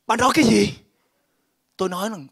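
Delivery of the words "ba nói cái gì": shocked cry. Retold or imitated. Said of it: "bắt đầu quá kinh hãi".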